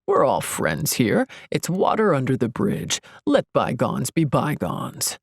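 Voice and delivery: a hint of a smooth, shrugging charmer; lightly voiced self-help guru, unbothered; lightly voiced smug Ian. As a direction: brush it off with easy charm. Ian's smooth, smiling voice.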